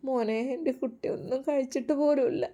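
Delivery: high, soft tearful lament; acted weeping; crying mother. A narrator's reading. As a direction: pour out a mother's helpless grief. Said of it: "നിങ്ങൾ കരയല്ലേ".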